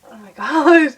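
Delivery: loud, laughing mock gasp; laughing disbelief. Reacting to a stranger's serious texts, a loud laughing gasp. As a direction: laugh it out, half shocked and half amused.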